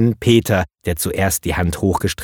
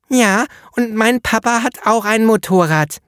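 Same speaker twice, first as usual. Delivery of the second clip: put-on high child's voice, eager; little girl; 12 semitones higher than usual. A narrator's reading. Little girl finishing the painter's sentence, high child voice. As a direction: pipe up like a small know-it-all girl. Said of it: "antwortete sie".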